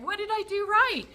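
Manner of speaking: very high, eager dog voice; voicing the excited dog. Dog reacts to owner's happy tone, high excited voice. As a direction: squeak it out as a delighted pet. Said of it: "And so Reba's like".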